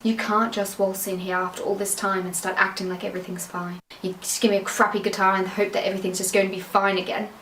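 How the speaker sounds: ordinary talk, nothing marked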